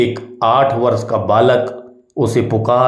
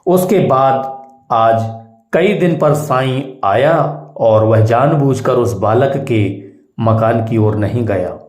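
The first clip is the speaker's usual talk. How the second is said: usual speaking voice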